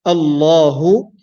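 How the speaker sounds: Allahu slow with a full, heavy lam. Shown as a demonstration. Teacher demonstrating the heavy lam for students. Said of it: "in a heavy manner".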